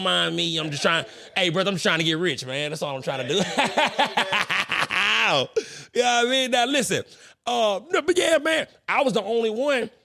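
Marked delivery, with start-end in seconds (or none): 3.4-5.9 cracking up at himself; real laughter; high laugh. Real, not acted. Laughs at his own joke.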